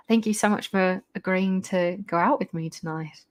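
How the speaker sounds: put-on a gentleman's Australian accent, recovered. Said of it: "I've lost the Australian accent".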